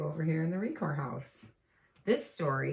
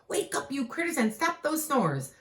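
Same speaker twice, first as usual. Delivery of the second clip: higher pleading wake-up call; lightly voiced Jack calling; a hint of a kid calling out; child calling out; 10 semitones higher than usual. Calling the animals.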